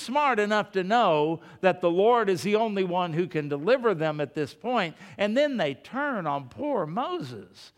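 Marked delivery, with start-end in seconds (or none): none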